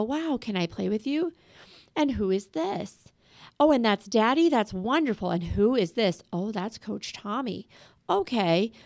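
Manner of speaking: put-on soft, light curious questions to a child; casual gentle asking. Shown as a demonstration. Parent casually asking the child about the dolls, softly. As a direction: keep it soft and easy, just curious. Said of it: "come over and go".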